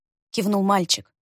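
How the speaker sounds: ordinary delivery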